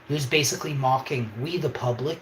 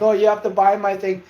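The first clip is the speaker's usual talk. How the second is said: mock salesman; 7 semitones higher, 8 dB louder, faster than usual. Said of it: "Most people are like".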